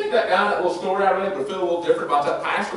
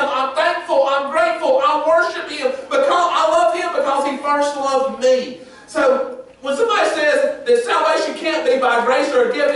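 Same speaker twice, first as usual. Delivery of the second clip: full-force preaching; 7 semitones higher than usual. Acted. Pastor drives home salvation to the congregation, preaching at full force. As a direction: preach it out, building to a shout.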